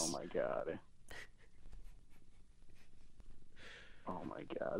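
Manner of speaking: breathy mortified whisper; embarrassed whisper. Acted. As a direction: whisper it in shame. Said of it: "I feel like such an idiot".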